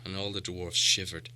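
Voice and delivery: ordinary delivery, nothing marked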